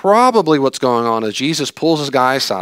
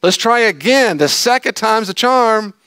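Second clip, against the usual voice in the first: high, loud, pushy; hammed-up teacher pressing the point; high, loud, slow at the end; comic loud Jesus; 11 semitones higher, 5 dB louder than usual. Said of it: "I can hear Jesus look at the disciples and go".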